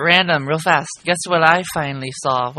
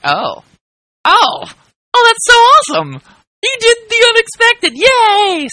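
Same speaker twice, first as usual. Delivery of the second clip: high, loud fangirl squeal; gushing excitement; 6 dB louder, slower than usual. Retold or imitated. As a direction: squeal with delight, much higher. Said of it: "I'm like".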